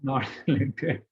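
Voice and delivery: real laugh mid-phrase; tertawa geli. Real, not acted. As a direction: break into laughter while reading it out. Laughing at own string of rejections.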